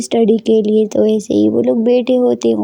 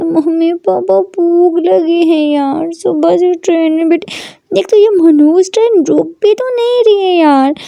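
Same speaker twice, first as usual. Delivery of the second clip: whiny daughter; 6 semitones higher than usual. Acted. Daughter complaining of hunger.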